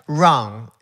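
RON with an effortless English R; R gringa. Retold or imitated. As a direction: say RON the American way. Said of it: "No tenía que hacer ningún esfuerzo". (ron con erre inglesa, sin esfuerzo)

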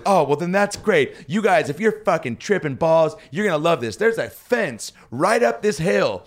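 high-pitched, gushing enthusiasm; hammed-up excited recommendation; imitated eager wife. Imitating the eager wife's suggestion.

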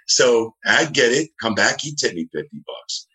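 ordinary talk

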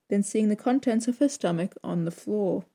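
ordinary delivery

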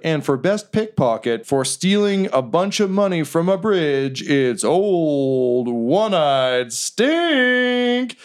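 big drawn-out winner announcement; grand award-show reveal, slow on the last line; host announcing winner. Acted. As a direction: stretch out the name like a showman, very slowly. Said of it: "they're like".

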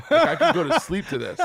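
breaks into high laughter mid-quote; quoting his stoned self, cracking up; laughing. Retold or imitated. Retelling dozing in class, laughing through it.

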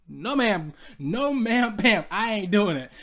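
loud, firm no ma'am; playful but loud refusal; emphatic refusal. Real, not acted. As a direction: shut it down with force.

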